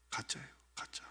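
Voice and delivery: hushed whisper; whispered aside. Real, not acted. Preacher whispering to the congregation. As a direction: whisper it like a secret.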